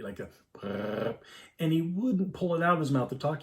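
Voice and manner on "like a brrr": slow lip-buzz of a pacifier; imitated binky noise. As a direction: buzz like a baby sucking a binky. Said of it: "he used his binky like the Homer Simpson baby".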